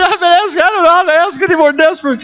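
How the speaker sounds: high, sobbing desperate plea; crying plea. Retold or imitated. Pastor weeping in the bathroom, begging God, sobbing. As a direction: cry out that you've asked all morning. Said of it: "And I cried".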